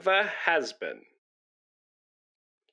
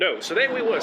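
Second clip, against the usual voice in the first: ordinary talk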